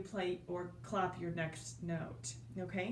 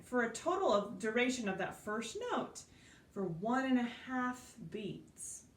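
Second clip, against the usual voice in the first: ordinary talk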